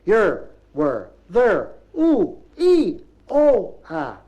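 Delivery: loud Bristolian calls, word by word; each Bristolese syllable punched out, rising high; monosyllabic Bristolese. Acted. Navvy points out tea-drinking foreman to friend, loud Bristolese. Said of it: "It went like this".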